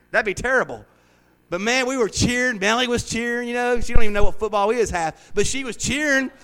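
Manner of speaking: ordinary talk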